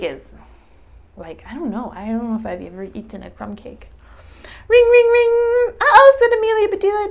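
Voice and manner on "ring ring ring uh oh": high loud slow ringing voice; phone ring. Acted.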